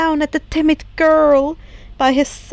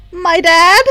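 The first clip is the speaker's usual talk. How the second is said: loud, shocked blurt; stunned outburst; 4 semitones higher, 7 dB louder than usual. A narrator's reading. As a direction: blurt it out in disbelief. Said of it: "Izuku said".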